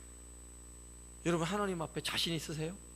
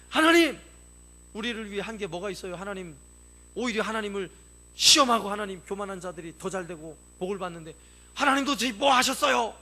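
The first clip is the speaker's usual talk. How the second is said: loud, high mock grievance at God; lightly voiced resentful people; complaining to God; 3 semitones higher, 8 dB louder than usual. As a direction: shout the grievance up at heaven.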